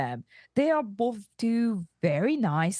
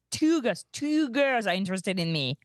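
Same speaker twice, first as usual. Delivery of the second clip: proud boast, hitting "two"; stress on two. Acted. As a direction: lean hard on "two". Boasting about two girls.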